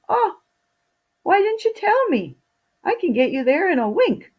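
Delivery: eager to help, rushing in; eager invisible servant. Acted. Invisible servant reassures tired Fido, high and eager.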